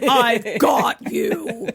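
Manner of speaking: mock manly voice. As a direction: draw it out like a newly manly hero. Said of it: "to talking like this".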